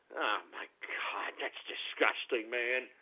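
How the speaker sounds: low, hushed, slow disgust; real disgust, voice dropped very low; disgusted groan. Real, not acted. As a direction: sink into a quiet, appalled mutter. Disgusted reaction to a username.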